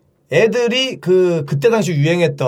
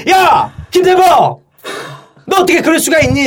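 high, loud mock reprimand; outraged class president; 9 semitones higher, 4 dB louder than usual. Class president rebuking the pupil who made everyone laugh, outraged. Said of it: "빵 터지면서 반장이".

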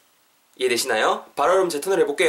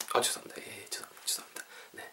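sheepish whisper, fading almost to nothing; whispered apology; 3 semitones lower, 17 dB quieter, faster than usual. Real, not acted. Teacher apologizing to learners for using grammar, whispered. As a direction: whisper a repeated apology under the breath.

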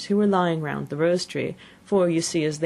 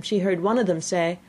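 usual speaking voice

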